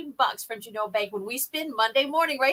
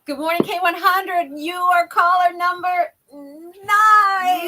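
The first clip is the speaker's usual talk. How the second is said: high, slow, drawn-out caller announcement; sing-song the caller number like the co-host; Marco impression; 8 semitones higher, 7 dB louder, slower than usual. Co-host mimics absent Marco's caller call. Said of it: "Here's my Marco impression".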